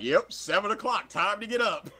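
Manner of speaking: high, chirpy wake-up call; the body chirps back brightly; body's cheerful reply. Retold or imitated. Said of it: "You're like".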